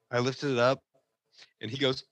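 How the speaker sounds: normal talking voice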